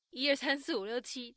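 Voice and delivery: ordinary talk, nothing marked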